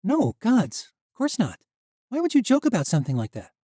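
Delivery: put-on young woman voice. A narrator's reading. Misha's voice, uneasy.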